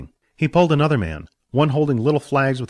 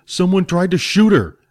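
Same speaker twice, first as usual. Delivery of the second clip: put-on urgent young hero; 8 semitones higher, 5 dB louder than usual. Billy protests urgently.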